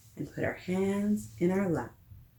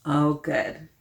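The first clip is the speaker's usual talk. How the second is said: usual speaking voice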